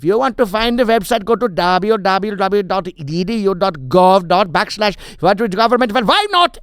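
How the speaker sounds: put-on loud, high Indian-accented rant; impatient Indian accent. Comic playing the impatient unemployment official, Indian accent. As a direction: build to an exasperated high shout in an Indian accent. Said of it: "he was impatient. That was his whole character".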